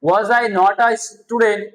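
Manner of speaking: slow, deliberate practice sentence; slow drill. Shown as a demonstration. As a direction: spell out every word slowly. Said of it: "খুব দ্রুত বলার practice করো". (ধীরে ধীরে স্পষ্ট করে বলা)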